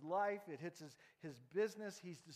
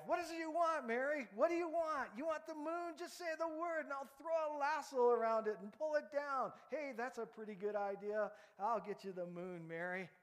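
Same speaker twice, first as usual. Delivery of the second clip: put-on much higher, excited young lover; eager George; 9 semitones higher, 5 dB louder than usual. George wooing Mary, much higher and excited. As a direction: gush at her like a smitten boy.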